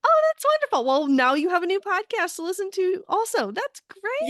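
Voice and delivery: high-pitched, gushing excitement; real delight. Real, not acted. Host cheering the guest's podcast news, delighted.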